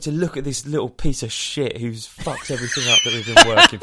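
fast, loud hammed-up telling-off; mock angry rant. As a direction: berate him in front of everyone, mock furious. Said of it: "calling him out in front of everyone".

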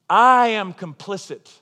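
solemn self-indictment; slow emphatic confession. Real, not acted. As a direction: lean hard on each word of I am complicit. Pulpit confession, repeated refrain.

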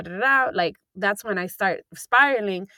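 ordinary talk

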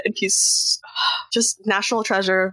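ordinary talk, nothing marked

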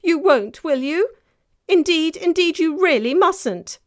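put-on high, loud child's protest; alarmed pleading. A narrator's reading. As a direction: protest in panic as a young girl.

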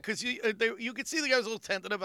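ordinary delivery

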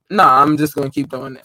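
ordinary delivery

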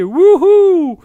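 a big, loud, high whoop; party whoop. Acted. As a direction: let out a festive cheer. Party cheer on air. (crie un wouhou de fête, haut et fort)

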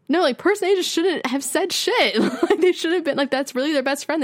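high, laughing indignation; laughing while venting. Real, not acted. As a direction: laugh through the complaint, pitch up. Laughs while venting about friends.

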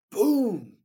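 sudden loud mock bang; loud imitated door boom. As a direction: slam it out like a door hitting you. Door slams into him.

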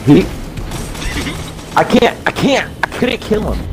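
frustrated high yelp. Real, not acted. Frustrated after failing a kill.

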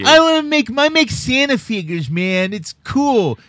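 high, loud character whine; put-on whiny protest. Co-host protests being laughed at, high loud put-on voice. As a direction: push it way up high, drawing out the last words.

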